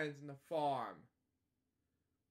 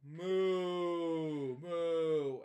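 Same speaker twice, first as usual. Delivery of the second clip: voiced moo, a little louder; cow moo; 5 dB louder than usual. Reading a kids' book aloud, imitating the cow's moo.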